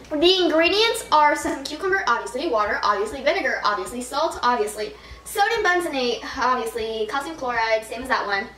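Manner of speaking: normal talking voice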